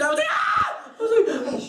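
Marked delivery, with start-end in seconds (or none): 0.3-0.9 hammed-up "Ah!"; retold scream. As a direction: imitate a startled scream. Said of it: "I screamed".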